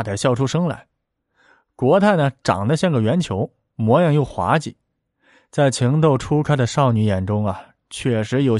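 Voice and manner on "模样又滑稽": plain reading voice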